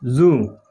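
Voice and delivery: जू with a hard j as in jug; जू said wrong with a plain j; wrong zoo. Shown as a demonstration. Teacher showing the wrong way, जू with a hard j. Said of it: "जू नहीं".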